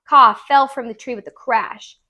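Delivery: ordinary delivery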